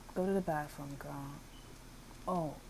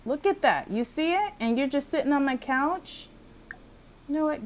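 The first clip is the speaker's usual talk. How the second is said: loud, high-pitched telling-off; play-acted outrage; 8 semitones higher, 7 dB louder than usual. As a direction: scold an idle visitor, voice raised high. Acted quarrel with idle landlord.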